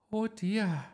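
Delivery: high-pitched, loud dismay; dismayed teacher. A narrator's reading.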